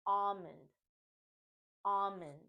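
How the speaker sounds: almond as AH-mun, no L; A as in olive. Shown as a demonstration. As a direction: model almond opening like olive and ostrich. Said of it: "the A's sound similar to words such as olive".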